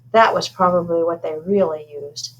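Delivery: plain reading voice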